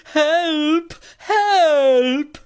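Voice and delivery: high frantic cries of help; cry for help. Acted. Visitor under pistol fire cries out in fright. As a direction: yelp for help as the shots fly.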